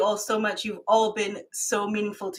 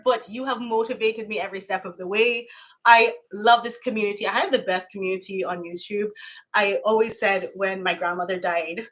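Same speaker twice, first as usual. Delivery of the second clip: usual speaking voice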